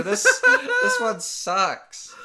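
squeaky laughing high complaint. Real, not acted. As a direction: laugh through the words.

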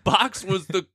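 slow, high put-on voice; string the listener along as a comic mover; teasing mover. Mover teases about the last box.

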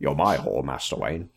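very low, soft British butler; deep British film-star impression; Michael Caine. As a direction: murmur it low in a British accent. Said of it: "kinda is Michael Caine".

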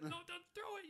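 squeaky, panicked "don't throw it"; mock wail, very high; high pleading cry. Hogarth begging the giant not to throw the car, high pleading wail.

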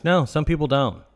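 ordinary talk

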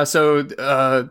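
ordinary delivery

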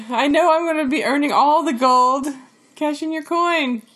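gleeful gold-hoarding leprechaun. Retold or imitated. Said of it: "You sound like a leprechaun".

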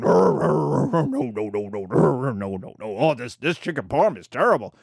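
put-on grumbling old folks. Old people grumbling disapproval at table.

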